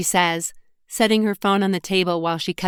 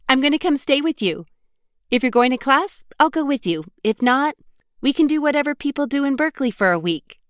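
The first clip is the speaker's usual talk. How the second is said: Sarah's voice pitched up with excitement; eager friend; 8 semitones higher, 3 dB louder, faster than usual. A narrator's reading. Best friend announcing her surprise visit, excited and quick. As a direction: bubble over with the plan, speeding up at the end.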